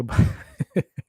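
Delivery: short airy laugh at own joke; breathy laugh. Real, not acted. Laughing at his own knock-knock joke, a short laugh. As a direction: let a quick laugh slip out.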